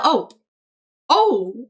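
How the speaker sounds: ordinary talk, nothing marked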